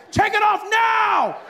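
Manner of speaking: drawn-out high, loud yell; shouted order. Retold or imitated. Security officer at end of patience, shouting. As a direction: escalate to a full mock bellow. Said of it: "this man shouting at you".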